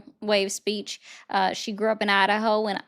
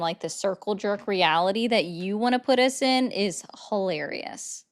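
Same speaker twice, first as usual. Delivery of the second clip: normal talking voice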